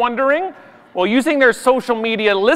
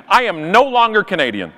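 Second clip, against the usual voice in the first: ordinary delivery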